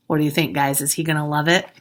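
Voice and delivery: ordinary delivery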